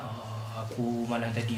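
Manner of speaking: low, soft mock-mystic murmur; imitated mystic mumbo-jumbo. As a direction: quietly imitate a self-styled mystic's revelation. Imitating a deluded mystic talking.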